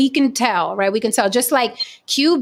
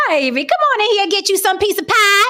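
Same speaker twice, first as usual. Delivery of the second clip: mock Southern accent; 12 semitones higher, 4 dB louder, faster than usual. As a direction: play a syrupy Southern hostess accent, pitched way up. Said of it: "British accents or Southern accents".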